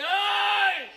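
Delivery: loud, high "hey!"; big stage holler; commanding shout. Acted. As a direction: call out sharply to challenge him. Accosting the other character.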